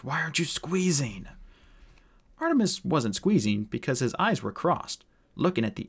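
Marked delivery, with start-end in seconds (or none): 0.0-1.3 higher, strained demand; desperate gasping dwarf; pained gasp. Acted. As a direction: plead through the pain. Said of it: "gasped the dwarf".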